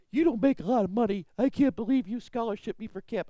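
voicing the teen. Pastor voices the grateful kid.

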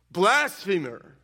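loud, slow accusation; accusing cry. Acted. Council accusing Jesus, loud and slow. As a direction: spit out the charge.

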